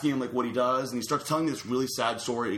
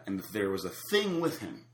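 ordinary delivery

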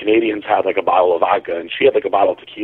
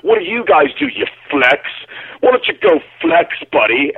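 mock drunk strongman screaming; 8 semitones higher than usual. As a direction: rant like a cocky drunk strongman bro. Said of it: "he was, like, screaming".

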